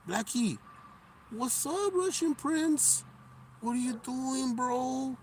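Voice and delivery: high sing-song voice calling a cat; pet talk voice. Real, not acted. Streamer greets his street cat in high pet-talk voice.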